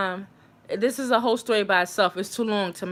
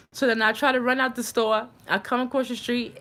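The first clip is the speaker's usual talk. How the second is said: ordinary talk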